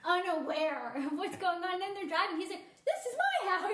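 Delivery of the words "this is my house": high, loud mock bewilderment; baffled exclamation. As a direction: imitate a man baffled, pitched right up. Retelling his baffled line.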